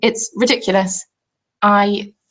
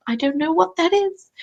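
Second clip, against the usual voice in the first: higher-pitched mock bewilderment; retold bewilderment; 7 semitones higher than usual. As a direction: act out being baffled, pitched up. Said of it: "And I'm just like".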